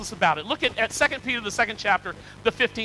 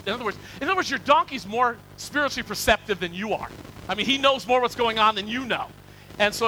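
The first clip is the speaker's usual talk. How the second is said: ordinary talk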